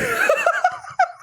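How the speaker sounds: high shrieking laugh; real helpless laughter. Real, not acted. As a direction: crack up at the screen. Laughs at the horny AI.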